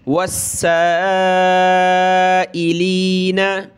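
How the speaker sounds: वस्साइलीना with the सीन doubled hard and the vowel drawn long; slow chanted वस्साइलीना with a hard doubled सीन; stretched stressed sin. Shown as a demonstration. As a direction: lean on the सीन in वस्साइलीना and hold the madd. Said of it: "मद करेंगे और इसको सख्त करेंगे सीन को".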